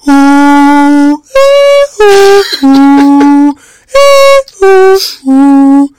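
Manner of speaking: high, loud sung ooh hook; ooh vocal hook. Retold or imitated. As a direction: belt the falsetto ooh like the record. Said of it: "getting to go, ooh".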